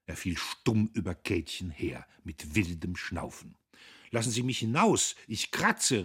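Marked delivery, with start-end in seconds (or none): 4.1-6.0 raised, quick, loud protest; crying out. A narrator's reading. Girl demands to be let out, crying out. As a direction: threaten him, on the edge of a screech. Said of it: "rief sie und wollte kreischen".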